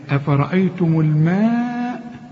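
slow recitation, الماء held for four beats; four-count madd. Shown as a demonstration. Teacher models madd for students, long held vowel. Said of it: "مد أربع حركات".

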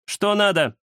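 high-pitched curt she-cat challenge; angry demand. A narrator's reading. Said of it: "гневно зыркнула в его сторону кошка". (сердитый вопрос высоким голосом)

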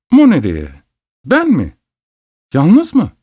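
put-on high, excited boyish disbelief; high-pitched, incredulous little questions; astonished questions. A narrator's reading. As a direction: ask in amazement, pitch leaping up. Boy astonished at being sent alone.

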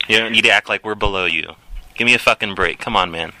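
normal talking voice

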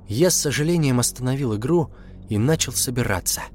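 plain reading voice

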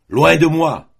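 thundering shout. A narrator's reading. Outlaw driving the boy away, thundering shout. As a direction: blast it out like thunder. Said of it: "d'une voix foudroyante".

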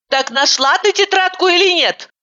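high, irritated repeat of the question; angry. A narrator's reading. Driver to distracted Ivanova, losing patience, angry. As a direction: snap the question again, fed up. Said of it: "рассердилась я".

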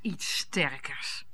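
wife scoffing at husband wanting liquor; scornful repeat. Acted. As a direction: repeat his words in disbelief.

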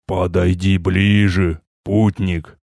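put-on slow, deep, majestic old voice; majestic old man. A narrator's reading. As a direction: call down like an ancient sage. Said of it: "Величественный голос донёсся сверху".

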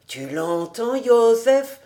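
plain reading voice

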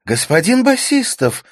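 loud, high cry of recognition; exclaiming. A narrator's reading. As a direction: exclaim in pleased surprise. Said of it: "воскликнула она наконец".

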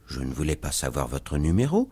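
low, soft, understated surprise; mild surprise. A narrator's reading. Pickwick answers the cab driver, mildly surprised. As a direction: answer quietly, a touch taken aback. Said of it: "dit monsieur Pickwick avec surprise".

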